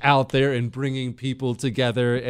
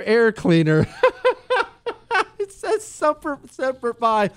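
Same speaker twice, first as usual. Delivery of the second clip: real high-pitched cracking up; words squeezed out in high laughter; laughing; 12 semitones higher than usual. Real, not acted. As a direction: try to read on through helpless laughter. Cracks up at the email joke.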